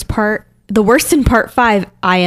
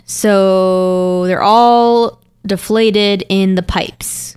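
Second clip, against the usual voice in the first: slow puzzled; much slower than usual. Real, not acted. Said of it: "I'm just trying to read this".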